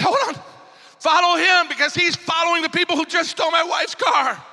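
high-pitched urgent re-enacted shout; frantic shouted order. Man to second armor bearer during car theft, frantic re-enacted shout. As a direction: yell after a departing driver. Retold order to chase the thieves.

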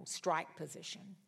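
ordinary delivery

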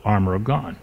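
ordinary talk, nothing marked

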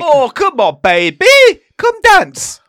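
high loud teasing call; loud coaxing. Real, not acted. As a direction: coax him, high and loud. Coaxing friend loudly.

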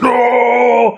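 hammed-up hero's defiant no; mock hero's shout; mock heroic no. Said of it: "He's like".

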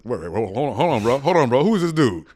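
puffed-up ego demanding who this dude is; boisterous ego voice. Acted. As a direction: play the outraged ego, rising to a high, loud challenge. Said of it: "That's how my ego talks".

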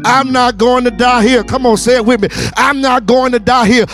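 driving, rousing sermon delivery; full-force preaching. Real, not acted. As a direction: preach it hard and urge them to respond.

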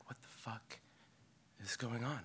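hushed, breathless disbelief under the breath; stunned whisper. Retold or imitated. Surfer waking from a beach nap to chaos, stunned whisper. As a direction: whisper it like you can't believe your eyes. Shock on seeing people run.

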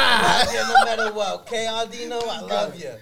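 high, loud, laughing Ma voice; doting Italian-American mother. Acted. Ma reassuring Aldino of her love, high and gushing. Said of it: "I'm gonna set up a scenario".

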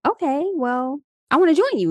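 eager high chirp. Retold or imitated. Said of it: "I'm like".

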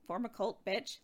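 ordinary delivery

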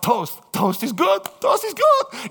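rising excitement, last line shouted high; mock delighted cry; excited. Excited reaction to toast promise.

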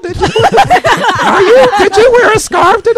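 high, loud nagging mom. Acted. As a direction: shout it like a mother checking up. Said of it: "That's my mom voice".

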